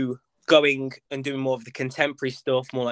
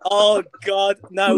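ordinary delivery

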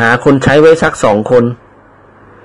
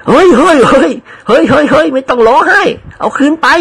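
high, hurried calming voice; hurried hushing; 12 semitones higher than usual. Acted. Friend hurries to stop servant crying, high hurried voice.

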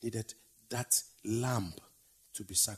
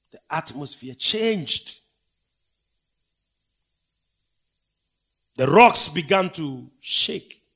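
slow, rising to loud and high; building preacher's roar; 4 semitones higher, 14 dB louder, slower than usual. Acted. Preacher building the earthquake scene for the congregation, slow and swelling loud. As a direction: build the quake louder each line.

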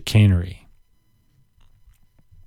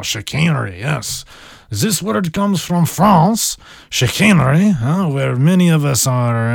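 put-on crappy French accent; 3 semitones higher than usual. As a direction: play a badly faked Frenchman relishing the word. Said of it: "apologize for my crappy French accent".

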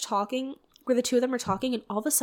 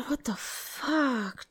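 whispered, slow disbelief; a slow whispered what the fuck; stunned whisper; 7 dB quieter, much slower than usual. Real, not acted. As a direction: breathe it out slowly in shock. Stunned reaction to scene.

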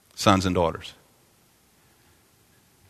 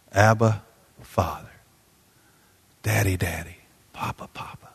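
fading from soft to a breathy whisper; tender whisper; 3 semitones lower, 6 dB quieter than usual. Acted. Preacher softly calling God Papa in a whisper. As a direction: say it like a child whispering to a father.

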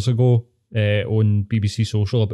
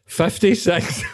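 higher, laughing as he says it; laughing punchline; 6 semitones higher, much slower than usual. Acted. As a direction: deliver the age while cracking up. Punchline of casting-couch joke.

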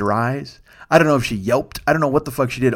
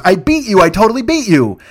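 mimicked gloat, raised and louder; 6 semitones higher, 6 dB louder than usual. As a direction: imitate her crowing over the win. Retold repeated gloating by winner.

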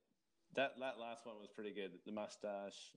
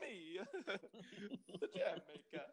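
high Italian jam-maker, half laughing; comic Italian accent; 7 semitones higher, 8 dB louder than usual. Acted. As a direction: announce yourself as a cartoon Italian, amused. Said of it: "what's he Italian again".

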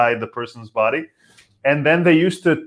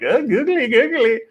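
mock dazed stoner; 12 semitones higher than usual. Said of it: "you don't necessarily have, like".

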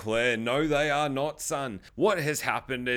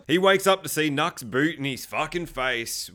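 ordinary delivery

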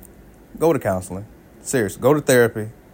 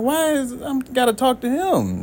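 puzzled question; 11 semitones higher than usual. Retold or imitated. Puzzled reply to the wife.

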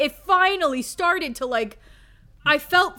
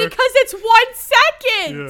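higher, louder, slow mocking drawl; mocking disbelief; 8 semitones higher, 9 dB louder, slower than usual. Real, not acted. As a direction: stretch it out in mock outrage. Mocking the movie's editing.

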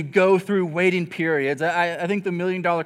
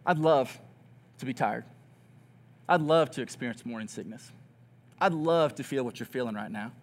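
1 wistful longing; 6 semitones lower, 6 dB quieter than usual. Retold or imitated. Longing to be pregnant, quoted.

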